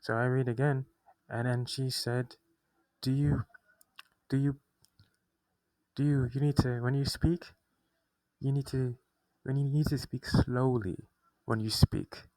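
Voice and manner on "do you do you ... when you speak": louder, higher imitation of an insistent evaluator; insistent instruction. Evaluator ordering her to slow down.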